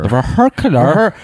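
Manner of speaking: "vehicular" garbled for a laugh; mangled pronunciation. Pretend. Co-hosts riffing on a mispronounced word, mangled pronunciation. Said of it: "Why are you saying it like that?".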